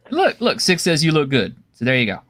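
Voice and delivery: normal talking voice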